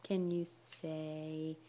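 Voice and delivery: slow coaxing. Real, not acted.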